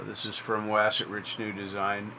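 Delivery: usual speaking voice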